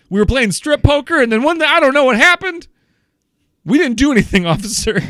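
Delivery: high, loud, pleading play-act to a cop; mock panicked plea to the police; pretend innocent excuse. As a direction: pitch it up and insist you did nothing, officer.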